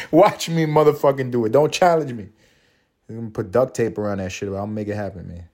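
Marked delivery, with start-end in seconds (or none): 0.1-2.3 playful boast. Real, not acted. Boasting he will smoke.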